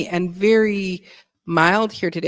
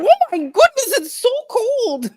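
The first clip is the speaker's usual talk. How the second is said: mock shivering complaint. Mocks a visitor walking out into the cold, high shivery squeal. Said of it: "you went".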